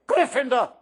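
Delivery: loud, high shout of the house name; ringing announcement; hat shouting. A narrator's reading. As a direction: call it out like a verdict. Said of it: "When it finally shouted".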